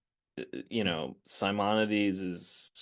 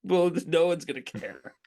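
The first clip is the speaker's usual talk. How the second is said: laughing, slow mock plea; half-laughing, higher, pleading with the actor; a touch of exasperated director; imitating the crew; 6 semitones higher, slower than usual. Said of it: "they were just like".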